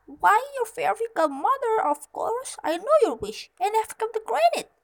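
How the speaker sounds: high, loud fairy voice; fairy godmother. A narrator's reading. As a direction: announce it as an emphatic fairy godmother. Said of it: "said the fairy".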